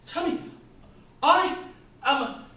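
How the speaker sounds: husband explodes at his friend about his wretched life; furious rant. Acted. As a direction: throw every grievance at him. Man rants about his martyrdom.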